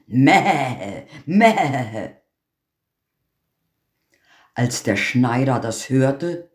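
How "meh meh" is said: goat bleat. Acted. The lying goat bleats at the tailor, mock bleat.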